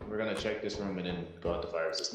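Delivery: normal talking voice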